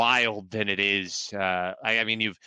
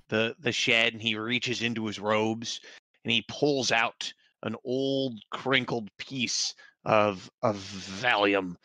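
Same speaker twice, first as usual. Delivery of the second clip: ordinary delivery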